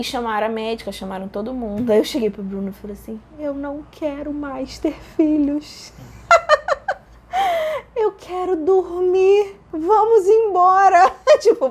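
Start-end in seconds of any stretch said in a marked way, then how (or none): 3.4-5.9 slow, drawn-out higher whine; drawn-out, comic labor moan; mock wail. Re-enacts her wail in labor. 7.9-11.1 high, slow, drawn-out wail; stretched-out labor whine, hammed up; mock wailing plea. Re-enacts delirious plea during labor.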